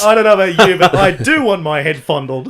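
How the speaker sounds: loud, laughing tease; loud joking reply. Real, not acted. Teasing friends about head massages, loud and laughing. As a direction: blurt it out cheekily, laughing. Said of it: "joking reply".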